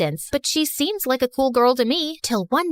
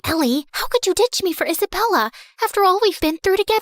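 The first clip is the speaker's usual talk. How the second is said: higher, fast hurt accusation; angry; 7 semitones higher, faster than usual. A narrator's reading. Jealous friend confronting Ellie, higher and fast. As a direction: snap at her as a jilted friend. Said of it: "Lucy stomped over angrily".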